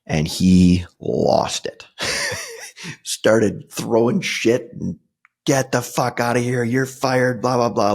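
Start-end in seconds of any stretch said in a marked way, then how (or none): 5.5-7.9 imitating a furious boss, pitched up; mock yell of an angry boss firing him; retold boss yelling. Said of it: "he is screaming".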